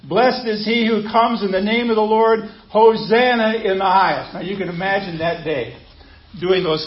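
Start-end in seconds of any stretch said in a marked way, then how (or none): 0.0-4.3 raised, louder joyful acclaim; raised voice, as a cheering crowd; crowd shouting. Retold or imitated. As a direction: call it out like the crowd. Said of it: "they were shouting in verse nine".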